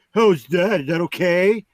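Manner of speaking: mock the smart-aleck kid asking if that's good enough; hammed-up snide boyfriend; snotty boyfriend. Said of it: "very just be like".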